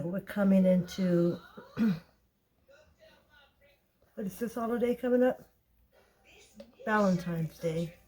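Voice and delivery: ordinary talk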